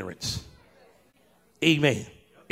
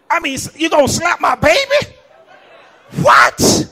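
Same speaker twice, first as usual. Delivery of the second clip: outraged shout; 10 semitones higher, 7 dB louder than usual. Real, not acted. Outraged at the baby slap.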